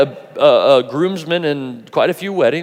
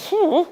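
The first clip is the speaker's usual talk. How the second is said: amazed whew. Retold or imitated. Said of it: "you're gonna go".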